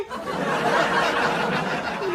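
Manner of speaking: breathless unvoiced laugh; airy laugh. Real, not acted. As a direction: let out a breathy giggle. Laughs at own joke.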